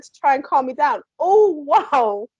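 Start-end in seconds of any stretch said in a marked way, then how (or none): none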